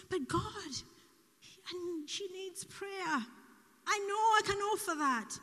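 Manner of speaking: high-pitched, earnest plea; pleading protest. Retold or imitated. Speaker arguing with God to pray, pleading.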